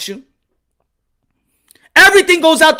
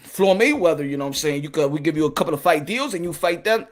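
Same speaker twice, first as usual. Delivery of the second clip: very low, soft evasive executive; Al Heyman; 8 semitones lower, 7 dB quieter than usual. Acted. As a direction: hedge quietly, way down in the voice. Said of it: "Al Heyman's like".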